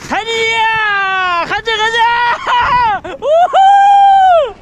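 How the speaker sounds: drawn-out high whoops; excited shouting. Real, not acted. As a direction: cheer while racing down. Sledding downhill, cheering.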